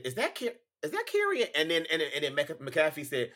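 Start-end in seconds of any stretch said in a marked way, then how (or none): 0.0-1.5 questioning Michael Cole. Retold or imitated. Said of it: "Michael Cole said".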